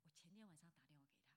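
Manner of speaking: ordinary talk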